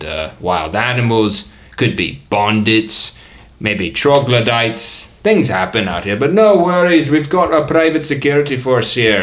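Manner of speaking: put-on muddled Australian-Scottish accent; mixed-up comic accent. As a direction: reassure passengers in a wandering Australian-ish brogue. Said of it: "He's Australian and Scottish".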